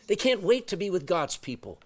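ordinary talk

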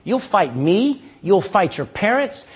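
normal talking voice

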